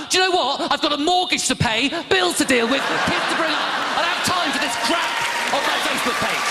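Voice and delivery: loud, high-pitched comic outburst; exasperated rant. Acted. Comic fed up with Facebook quotes, exasperated rant. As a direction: blow up, high and loud, at all this nonsense.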